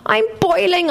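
put-on high whiny child's voice; whined. A narrator's reading. Nearly eight-year-old in the drum whines to Mum. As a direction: whine it like a hot, fed-up kid. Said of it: "I whined".

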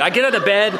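usual speaking voice

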